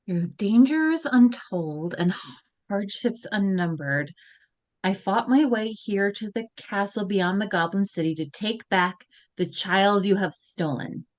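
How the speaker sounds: usual speaking voice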